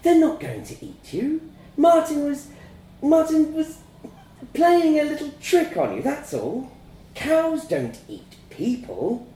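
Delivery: high-pitched motherly reassurance; soothing mum. A narrator's reading. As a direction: reassure a child in a high, coaxing voice. Mum soothes frightened child.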